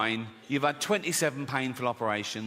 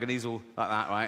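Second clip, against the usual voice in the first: ordinary talk, nothing marked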